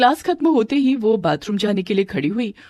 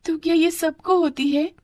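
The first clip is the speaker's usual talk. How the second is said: put-on high little-girl question; curious girl, pitched up; puzzled daughter; 10 semitones higher than usual. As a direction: ask innocently in a high child's voice. Daughter asks mother.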